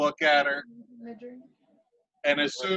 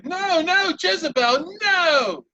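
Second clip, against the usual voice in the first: high, loud cry of no, no, Jezebel; alarmed shout; 12 semitones higher, 4 dB louder, slower than usual. Acted. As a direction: shout at the little demon to stop. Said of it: "you hear Sylvaria say".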